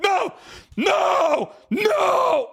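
very high, drawn-out pleading screams; desperate screaming protest. Acted. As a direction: scream it as if being dragged off. Dragged away, screaming in protest.

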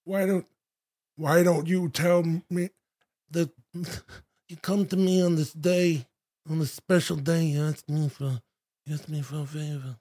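soft mumbled Brando; hushed Godfather mumble; Godfather impression. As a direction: murmur it like Vito Corleone. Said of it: "Marlon Brando".

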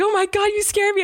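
high mock fright; scared husband. Retelling husband's reaction to the scare, high and alarmed. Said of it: "I really terrified Eric".

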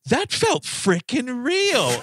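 high, slowly stretched mimicry; hammed-up movie line, verging on laughter; mock amazement. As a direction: draw it out in mocking awe. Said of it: "is what he says".

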